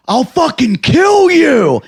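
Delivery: high, loud, drawn-out mad yell; crazed threat. Acted. Comic bluffing an audience member, crazed drawn-out yell. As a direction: go berserk, stretching out the threat as a scream. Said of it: "I'm gonna go cra".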